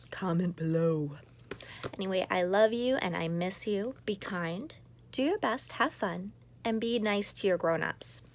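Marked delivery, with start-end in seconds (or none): none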